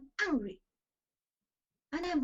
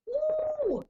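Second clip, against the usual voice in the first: high, loud ecstatic ooh; delighted ooh; 6 dB louder than usual. Real, not acted. Preacher thrilled by God's names, a delighted high ooh. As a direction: squeal it with delight.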